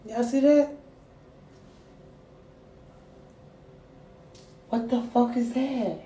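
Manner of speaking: high, alarmed asking the chat to look; alarmed disbelief. Real, not acted. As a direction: point at the rash in real shock. Shows skin rash to chat.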